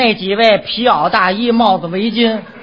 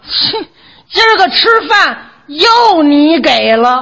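sulky whine; 6 semitones higher, slower than usual. Acted. Mock sulky complaint.

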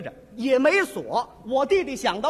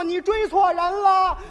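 shouting back; 6 semitones higher, 6 dB louder, slower than usual. Retold or imitated. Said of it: "我弟弟说".

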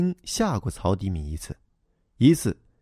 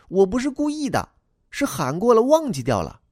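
put-on high, childlike voice for the little boy; young boy replying to the grown-up, high and eager; boy's voice; 12 semitones higher than usual. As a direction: play the earnest schoolboy explaining himself. Boy excuses himself.

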